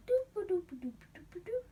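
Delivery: high sing-song doo-ba-doo; sing-song walking hum. Retold or imitated. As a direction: hum it while strolling off. Judas strolling off to the priests.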